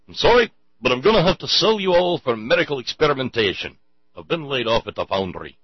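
badly faked Yorkshire father voice; failed Yorkshire accent. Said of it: "the entire wrong accent".

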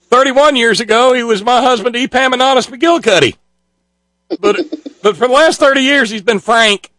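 high mock speech voice; Carly Farina. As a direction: keep up the hammed-up candidate impression. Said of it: "Carly Farina was a talking about her husband".

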